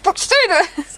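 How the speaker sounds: impatient inner voice losing patience; exasperated urging. Retold or imitated. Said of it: "And she's like".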